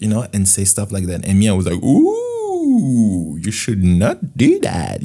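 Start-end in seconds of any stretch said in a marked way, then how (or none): 1.8-5.0 high scandalized "Ooh", then slow and prim; a shocked little goody-two-shoes scolding; judgy. Retold or imitated. Young [name] scolds his swearing friend, slow and prissy. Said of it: "I was so judgy".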